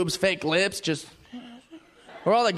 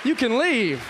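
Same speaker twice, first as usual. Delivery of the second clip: teasing gym buddy; 4 semitones higher than usual. Retold or imitated. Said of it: "be like".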